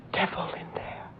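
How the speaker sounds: ominous whisper; fearful whisper. Acted. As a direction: hush it as a dire warning. Maid warns of evil.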